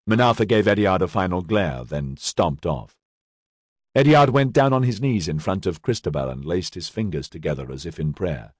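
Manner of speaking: ordinary delivery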